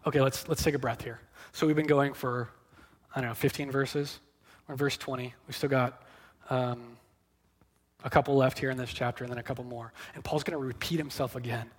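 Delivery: hushed, hurried side remark; quiet aside. Real, not acted. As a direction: drop out of preaching for a quick aside. Aside to take a breath.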